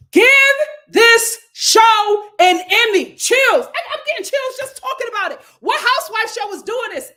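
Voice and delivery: high, emphatic demand, starting loud; fired-up raving. Real, not acted. Host demanding an award for the show, fired up. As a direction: punch out the praise like a campaign cry.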